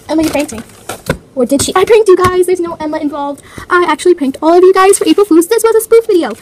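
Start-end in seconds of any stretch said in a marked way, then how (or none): 1.7-6.3 loud, raised, triumphant announcement; belted-out gotcha; gleeful prank reveal. Real, not acted. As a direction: crow about the prank you pulled. Gleefully reveals the April Fools prank.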